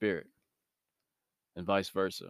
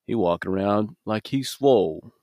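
louder mock remark in another man's voice; imitating a gossiping guy; 6 dB louder than usual. One inmate to another about a man walking by, mocking imitation. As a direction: mimic the guy passing comment on someone, not really whispering. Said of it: "That's exactly how he said it".

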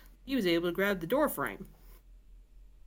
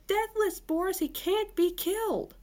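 alarmed; 13 semitones higher than usual. Acted. Sarah warns in alarm.

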